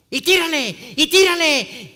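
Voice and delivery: high, full-volume taunting shouts; drawn-up, booming imitation of a heckler; mimicked yelling. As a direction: yell it like a bully egging others on. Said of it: "a gritar cada sandez".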